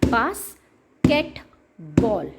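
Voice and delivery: slow, one tap per syllable: बास्केट-बॉल split into beats. Shown as a demonstration. Teacher modelling syllable tapping for parents, slow and segmented. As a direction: stretch बास्केटबॉल out beat by beat, very slowly. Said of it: "कितने आपने clapping की या आपने कितनी tapping की".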